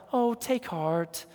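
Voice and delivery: slow, soft Southern lady coo; a gentle Southern drawl, hushed; sweet Southern woman. Retold or imitated. Pastor wishing he'd told the couple, soft Southern drawl. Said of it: "that sweet Southern woman".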